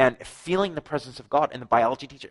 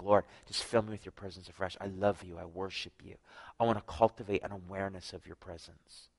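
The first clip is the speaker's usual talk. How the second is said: quiet prayer; 4 semitones lower, 8 dB quieter than usual. Shown as a demonstration. Modelled prayer for congregation.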